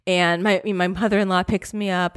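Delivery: ordinary delivery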